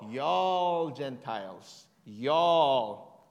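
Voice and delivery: Y'ALL stretched very long and loud; big, slow, loud stress on Y'ALL; drawn-out y'all. Shown as a demonstration. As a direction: hold the word Y'ALL, slow and emphatic. Stretches the substituted word while reading.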